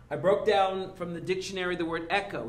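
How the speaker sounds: ordinary talk, nothing marked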